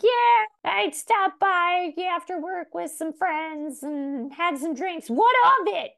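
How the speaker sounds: put-on high aggressive drunk mom voice; evil drunk mom. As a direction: snap back like a drunk mother. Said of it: "a little aggressive".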